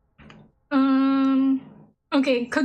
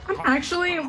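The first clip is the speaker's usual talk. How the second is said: chewing through giggles; laughing while chewing. Real, not acted. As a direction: mouth full and laughing. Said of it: "I'm actually struggling to chew because I'm, like, laughing".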